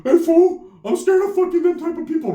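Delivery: high, scared put-on voice; panicked plea in a squeezed high pitch; imitating a frightened cousin, way up high; freaked-out primo. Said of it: "My primos over there all freaked out".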